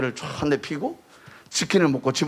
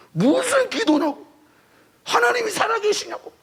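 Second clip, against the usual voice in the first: angry protest. Retold or imitated. Angry teen protesting at God.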